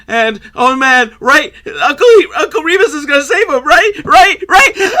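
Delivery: pleading right? rising each time; anxious excitement. Real, not acted. Dread building while watching film.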